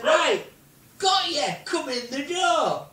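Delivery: high, loud yell on catching someone; triumphant shout. Retold or imitated. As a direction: holler as you haul them in.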